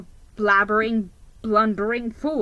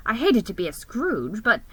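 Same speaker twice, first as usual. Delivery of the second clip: ordinary delivery, nothing marked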